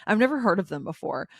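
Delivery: normal talking voice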